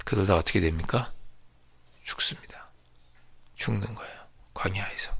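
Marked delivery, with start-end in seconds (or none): 2.0-5.1 dropped to a near whisper; hushed verdict. Real, not acted. Preacher tells the congregation they all died, whispered. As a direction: let the death sentence sink in softly.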